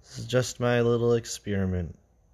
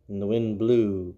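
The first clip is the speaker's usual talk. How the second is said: plain reading voice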